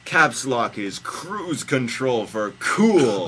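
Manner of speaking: loud, slow proclamation; mock slogan. Host joking about capital letters, loudly proclaiming. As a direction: announce it big and drawn out.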